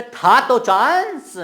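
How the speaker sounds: loud, high, drawn-out "था तो chance"; emphatic insistence. Shown as a demonstration. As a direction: stretch "chance" at high pitch. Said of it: "जोर देके बोलूंगा".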